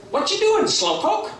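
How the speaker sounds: very high, cheeky bird voice; Berchicken Hawk. Acted. Hawk questions the waiting buzzard, high cheeky voice. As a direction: needle the slowpoke in a high squawk. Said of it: "Berchicken Hawk says".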